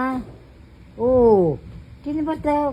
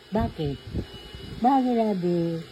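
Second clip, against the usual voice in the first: ordinary delivery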